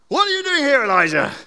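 booming high angry challenge; angry God. Acted. Preacher voicing an angry God confronting Elijah, booming. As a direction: thunder it down like a furious God. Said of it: "The angry, powerful God".

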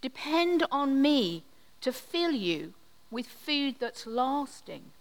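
ordinary delivery

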